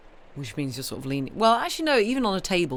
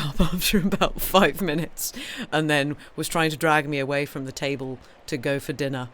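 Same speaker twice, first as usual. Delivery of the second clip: ordinary talk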